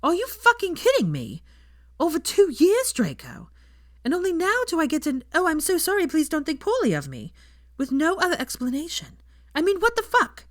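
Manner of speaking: loud, high furious ranting; outraged. A narrator's reading. Hermione rants to Draco about the apology, loud and furious. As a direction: explode with indignation. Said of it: "complete and utter outrage".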